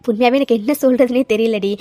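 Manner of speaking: ordinary delivery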